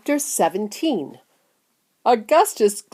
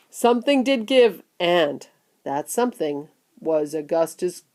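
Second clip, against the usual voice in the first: ordinary delivery, nothing marked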